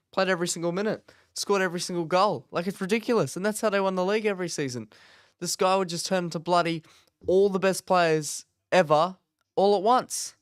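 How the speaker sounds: rising, loud exasperated rant; excited rant. Real, not acted. Liverpool fan ranting about a rival's late form, loud and exasperated. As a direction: pile it on, getting worked up.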